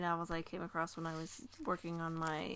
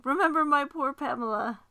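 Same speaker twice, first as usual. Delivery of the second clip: higher and louder, a mild pleading; dying lady's plea; 6 semitones higher, 8 dB louder than usual. Acted. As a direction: plead gently as the dying mistress. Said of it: "and then recovering".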